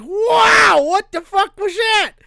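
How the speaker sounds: loud, high-pitched reenacted yell of shock; shocked outcry. Storyteller reenacts biting tinfoil in candy, shocked yell. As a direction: cry out as if you just bit foil.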